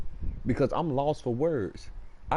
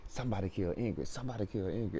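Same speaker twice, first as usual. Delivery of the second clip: soft, haunting repeated words; echoing in the head; 8 dB quieter, faster than usual. Retold or imitated. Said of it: "I can hear Felicia words echoing".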